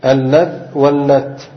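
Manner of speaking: اللذ واللت each stopped on its last consonant; vowelless final consonants. Shown as a demonstration. Teacher repeating the third form for students, اللذ واللت ending vowelless. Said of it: "حذف الياء مع إسكان ماذا".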